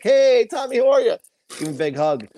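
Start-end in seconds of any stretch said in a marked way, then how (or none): 0.0-1.2 loud, high, over-friendly hail; hearty Jim Carrey greeting. Retold or imitated. Actor greeting his co-star at a restaurant, exuberant. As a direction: hail a colleague far too eagerly. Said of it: "went over to him and was like".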